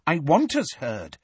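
usual speaking voice

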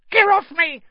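high, loud gnome squawk; angry gnome. Acted. Gnome to the boy lifting it, high protesting yell.

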